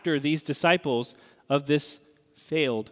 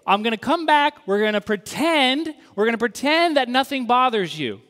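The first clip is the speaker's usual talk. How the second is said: much higher, louder, glib false cheer; mock breezy Jesus; 7 semitones higher, 6 dB louder than usual. What Jesus is not saying to Thomas, mock glib cheer. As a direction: parody the hollow promise. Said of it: "He's not saying".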